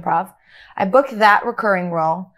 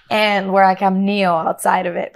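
normal talking voice

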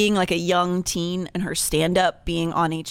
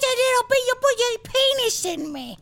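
very high, loud squeaky sex voice; 3 dB louder than usual. Pretend. Joking about partner in bed, very high and loud. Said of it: "your partner has to go".

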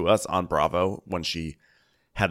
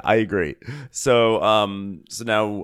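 ordinary talk

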